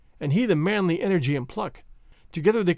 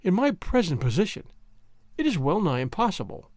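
usual speaking voice

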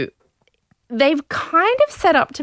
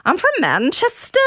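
high, hammed-up Manchester accent; shaky-grasp Manchester impression, pitched up; attempted Manchester accent; 9 semitones higher than usual. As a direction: introduce yourself as a would-be Mancunian. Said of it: "the Manchester accent".

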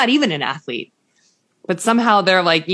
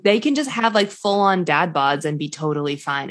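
usual speaking voice

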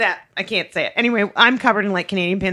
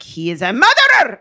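ranting in a mock Greek accent; bad Greek accent; 4 dB louder than usual. Greek driver going on and on, ranting bad Greek accent. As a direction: swell into the last word, hammed-up Greek accent. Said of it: "I can't do the accent".

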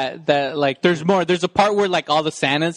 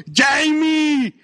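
calling out; 7 semitones higher than usual. Retold or imitated. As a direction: call out across a crowd. Calling out movie line.

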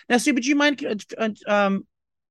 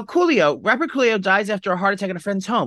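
reading a news item aloud, raised and brisk; reading the headline; 4 semitones higher than usual. Real, not acted. Friend reads the [name] news aloud on the podcast, announcer style. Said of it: "Oh, I have it here".

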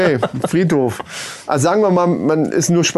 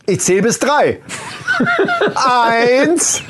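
high, laughing strict countdown; counting nanny; 9 semitones higher, much slower than usual. Acted.

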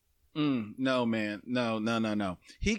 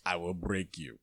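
Drago voice; 8 semitones lower, 4 dB quieter than usual. Retold or imitated.

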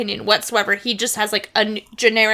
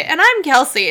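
mock eager "And I'm Kelsey," pitched higher; imitating Kelsey's eager intro; 5 semitones higher, 4 dB louder than usual. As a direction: copy her excited intro. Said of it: "Kelsey literally went to say".

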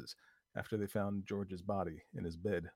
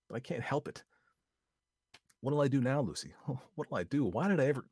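panicky; 6 semitones higher, 5 dB louder than usual. Acted. Vi panicking, laughing nervously.

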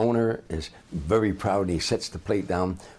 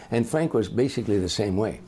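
normal talking voice

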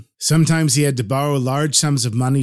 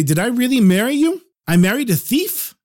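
shocked wife; 8 semitones higher than usual. Acted. Wife shocked at husband's theft.

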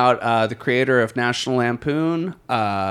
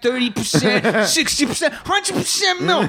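mobster pitchman hawking milk; high-pitched mock gangster salesman; mafia ad voice; 7 semitones higher than usual.

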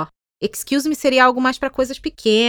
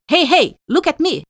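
mock call for attention; 4 semitones higher than usual. Shown as a demonstration. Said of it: "do que".

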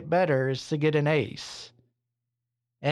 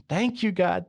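excited thanks; 4 semitones higher than usual. Retold or imitated. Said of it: "He's so excited".

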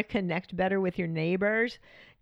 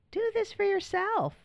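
"Do this for yourself." said again much higher, stressing "this"; emphatic high repeat; 14 semitones higher than usual. Shown as a demonstration. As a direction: jump way up in pitch and lean on "this".

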